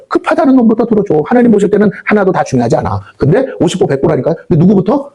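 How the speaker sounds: usual speaking voice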